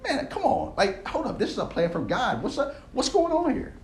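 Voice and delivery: high, soft, bewildered disbelief; incredulous protest. Acted. Builder protesting God's plan is questioned, high and incredulous.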